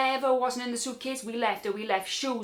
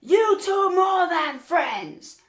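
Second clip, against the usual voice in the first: high, loud, drawn-out shout; imitated guard yelling slowly, pitched way up; going mental; 9 semitones higher, 7 dB louder, much slower than usual. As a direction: holler the accusation as an outraged guard. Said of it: "going mental".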